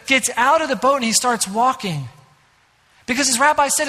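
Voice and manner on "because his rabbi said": ordinary talk, nothing marked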